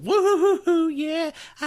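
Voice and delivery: high falsetto whooping, hammed-up; giddy celebration. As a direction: whoop like you're over the moon. Imagines himself celebrating wildly.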